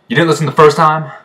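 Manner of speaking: sharp, loud, high-pitched scolding; imitating a loud stern officer. Older staffer scolding a recruit who asks again, loud and high. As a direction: bark it like an impatient drill staffer. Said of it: "They're loud".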